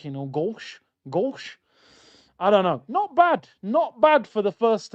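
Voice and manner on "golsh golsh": "Golsh" with the final S softened to sh; mock Dutch S on "Golsh"; Dutch S sound. Shown as a demonstration. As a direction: say "Golsh" with a slushy Dutch sh ending. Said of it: "They say the S is like".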